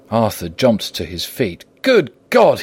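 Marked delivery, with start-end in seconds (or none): 1.8-2.6 high, loud exclamation of shock; horrified outburst; shocked cry. A narrator's reading. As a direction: cry out, leaping up in pitch. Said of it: "he cried".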